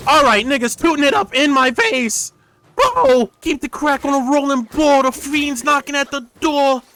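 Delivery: hammed-up high shouting at the screen; mock high yell. Streamer yelling at the game in a high mock voice. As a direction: yell it high and loud, hamming it up.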